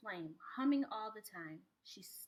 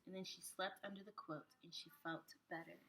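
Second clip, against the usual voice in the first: much softer, sinking into sleep; softer, soothing bedtime voice; soft sleepy hush; 3 semitones lower, 10 dB quieter than usual. A narrator's reading. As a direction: lull the words down to rest. Child falls asleep under quilt.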